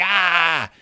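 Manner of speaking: put-on dismissive bully voice; a lightly voiced dismissive jerk; mock jerk voice. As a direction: sneer it like a cartoon jerk. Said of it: "He didn't actually talk like that".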